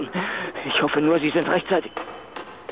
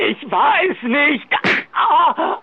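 slow, shouted denial breaking into a cry; cornered and crying out; panicked shout; 6 semitones higher, much slower than usual. Acted. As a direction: scream it out in terror. Cornered victim cries out.

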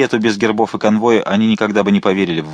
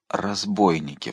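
slow, much softer hushed word; whispered; 7 dB quieter, slower than usual. A narrator's reading. Mazarin relieved after the patrol, hushed and slow. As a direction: breathe it out after holding his breath. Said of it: "прошептал он".

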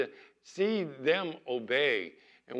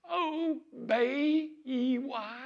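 put-on very high, slow toddler spelling; 13 semitones higher, much slower than usual. Little Claire spelling obey for her mother, very high and slow. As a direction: stretch each letter like a two-year-old. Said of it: "and she goes".

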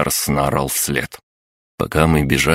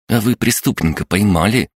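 put-on higher-pitched, curious child's voice; little boy; 6 semitones higher than usual. A narrator's reading. Dark-haired boy questions the policeman about his catch, curious child voice. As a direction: ask like an inquisitive small boy. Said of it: "спросил темноволосый мальчик".

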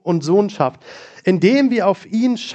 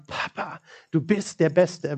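near-whispered first word, then soft and slow; hushed tenderness; 6 dB quieter, slower than usual. Acted. Believer telling God the Father he is the best, hushed and tender. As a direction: breathe the name, then linger over the praise.

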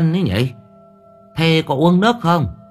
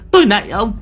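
high, loud begging cry; terrified old woman pleading; pleading shriek; 11 semitones higher, 5 dB louder, faster than usual. A narrator's reading. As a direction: beg for mercy, much higher and louder. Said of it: "Bà cụ Sâm rú lên".

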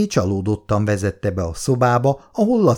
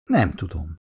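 absent-minded; 5 semitones lower, 5 dB quieter than usual. A narrator's reading. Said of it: "válaszolta szórakozottan Szabóné".

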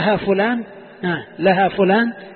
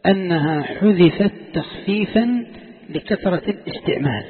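slow, spaced-out dictation; slower than usual. Shown as a demonstration. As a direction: spell it out slowly so students can write. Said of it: "قولوا".

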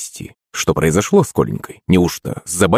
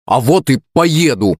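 put-on high, foot-stamping childish defiance; stubborn retort; 7 semitones higher than usual. A narrator's reading. Son contradicting his father, stubbornly defiant. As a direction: answer back with raised pitch. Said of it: "топнул ногой Коленька".

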